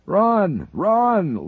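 high, loud panicked scream. A narrator's reading. Lincoln screaming at the team to run, loud and high. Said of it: "Lincoln screamed".

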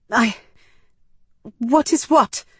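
high, halting, flustered question; startled, breathless. A narrator's reading. Frightened human answers the Medus, flustered. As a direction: stumble on the first word, then jump up in pitch. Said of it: "I shrieked, breathless".

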